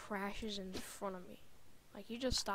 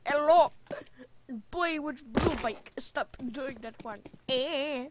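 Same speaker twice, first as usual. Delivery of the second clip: loud, hammed-up Chinese accent, higher pitch; mock Chinese accent; 4 semitones higher, 10 dB louder, slower than usual. Boy imitating the man telling him off, mock Chinese accent. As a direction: call out in an exaggerated Chinese accent. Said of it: "this, like, fat Chinese dude".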